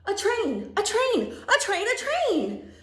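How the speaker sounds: loud, punchy questioning with lifted endings; eager pestering Sam. Acted. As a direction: pester them excitedly, bouncing each phrase. Reads Sam's part of the story.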